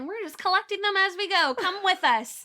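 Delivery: high, teasing come with us; mock-grand collector's boast; playful invitation. Tipsy sisters inviting Australians along, high and playful.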